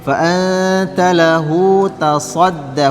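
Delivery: slow chanted recitation with long held sounds; Quran recitation. Shown as a demonstration. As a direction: chant it slowly as a model reading. (tilawah perlahan)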